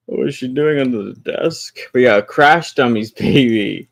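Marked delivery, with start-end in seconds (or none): none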